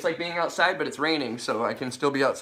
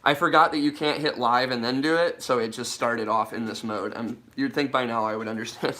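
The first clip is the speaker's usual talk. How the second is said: ordinary talk, nothing marked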